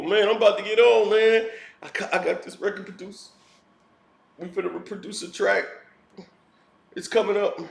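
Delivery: fast, offhand bragging in a lightly put-on voice; mock hustler bragging. Mocking a has-been who keeps promising a comeback, fast and offhand. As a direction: rattle it off like an empty promise.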